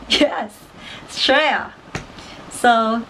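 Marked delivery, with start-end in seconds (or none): 1.1-1.7 "Australia" squeezed into "Straya"; shortened Aussie pronunciation. Shown as a demonstration. Confirming the slang for viewers, "Australia" shortened to Straya. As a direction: say "Australia" as STRAY-a, no first syllable or L. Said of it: "is Australia".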